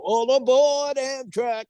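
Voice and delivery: high, slow announcer call; announcer line. Retold or imitated. Quoting his old commercial, slow high announcer call. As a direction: call it out like a train conductor ad.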